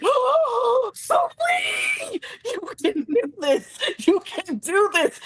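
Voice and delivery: very high wailing cheer; re-enacted tearful-sounding pep talk, high-pitched; frantic encouragement. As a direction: squeal it like a desperate fan.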